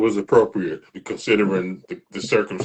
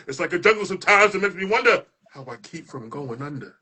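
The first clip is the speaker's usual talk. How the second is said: building, rhythmic spoken-word delivery; spoken word performance; 7 semitones higher than usual. Acted. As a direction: perform it like a poem slam, rising toward the shouts.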